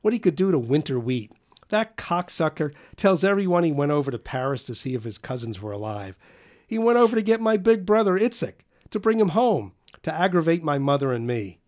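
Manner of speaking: high, loud, scornful tirade; a boy mocking his father to friends; agitated bitter rant. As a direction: spit out contempt for his father. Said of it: "taking the dim and agitated view".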